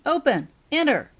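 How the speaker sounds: loud, high shouted commands; shouting. A narrator's reading. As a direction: yell the spell words at the door.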